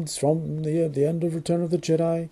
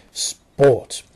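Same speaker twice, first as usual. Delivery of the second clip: sarcastic reveal; 5 dB louder than usual. Acted.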